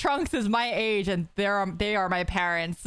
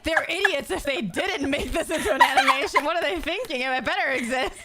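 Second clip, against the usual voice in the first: indignant friend; 9 semitones higher than usual. Retold or imitated. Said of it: "Mita's like".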